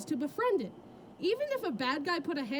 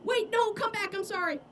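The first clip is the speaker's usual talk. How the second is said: panicked pleading; 6 semitones higher than usual. Pretend. Ham leaves, pleading.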